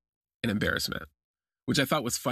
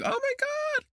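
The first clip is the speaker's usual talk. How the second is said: very high squeal of alarm; mock panic; slower than usual. Patient seeing the dentist's needles, high mock-panicked squeal. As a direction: squeak it out in hammed-up horror.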